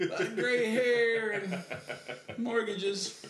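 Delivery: high, slow, mock-proud old hipster; aging hipster voice. Aging hipsters speaking together.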